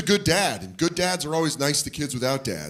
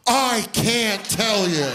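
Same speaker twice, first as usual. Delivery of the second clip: slow, higher coy refusal; sheepish refusal; 7 semitones higher, much slower than usual. Retold or imitated. Kid refusing to tell the dad, slow sheepish drawl. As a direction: stretch each word like a caught kid.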